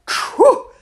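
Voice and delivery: high loud acted sneeze. Whole household sneezes, loud high sneeze. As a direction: another big exaggerated sneeze. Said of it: "sneezed the general and the chef".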